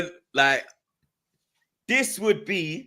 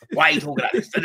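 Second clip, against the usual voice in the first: high mocking whine, higher than his usual voice; mocking imitation of critics; 11 semitones higher, much faster than usual. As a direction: mimic a baffled critic, teasing. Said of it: "That's what man was saying".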